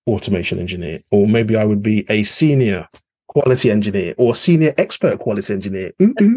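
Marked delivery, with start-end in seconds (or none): 6.0-6.4 high delighted coo; excited ooh. Real, not acted. Excited coo over a grand title.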